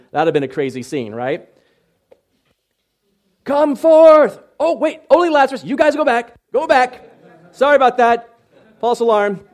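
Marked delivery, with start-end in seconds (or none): 3.4-9.5 high, loud comic backpedalling shout; mock calling out. Preacher plays Jesus sending the other dead back, mock calling out. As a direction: holler it out, then hastily call it off.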